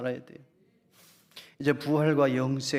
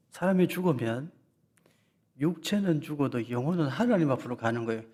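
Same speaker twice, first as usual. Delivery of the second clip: normal talking voice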